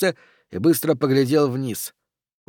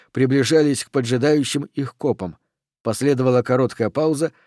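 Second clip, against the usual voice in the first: ordinary delivery, nothing marked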